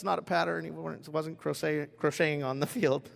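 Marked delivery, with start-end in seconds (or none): none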